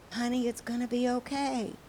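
soft, soothing reassurance; comforting father. Acted. Father answering his crying daughter, comforting. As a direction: hush and soothe a wailing child. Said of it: "he comforted his daughter".

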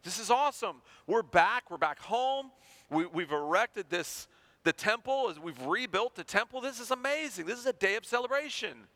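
put-on high, excited young crowd cheering; giddy celebration, high and bright with joy. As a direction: voice the young people overjoyed to be home. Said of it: "celebrating and cheering".

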